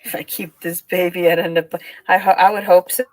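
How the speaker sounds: ordinary talk, nothing marked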